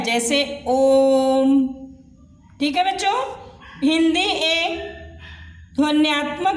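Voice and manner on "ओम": ओम stretched very slowly, held long; prolonged ओम. Shown as a demonstration. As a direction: draw out ओम three times as long. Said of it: "तिगुना समय लगता है". (ओम को तिगुना खींचकर बोलें)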